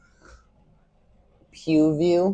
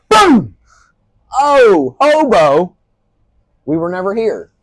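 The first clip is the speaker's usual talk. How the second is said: startled shout, much higher and louder; scared horror game streamer; 9 semitones higher, 12 dB louder, slower than usual. Pretend. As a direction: yell like something just jumped out at you. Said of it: "let something jump scare me".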